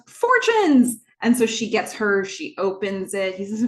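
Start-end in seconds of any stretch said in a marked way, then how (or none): 0.1-1.0 high, loud mock-excited cheer; excited exclamation. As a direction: belt it out in imitated glee. Imitates Katie's eager cry for cookies.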